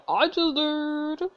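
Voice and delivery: high, slow, smug drawl. Real, not acted. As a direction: savour the comeback. Repeated smug.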